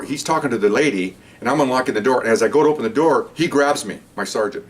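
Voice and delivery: ordinary talk, nothing marked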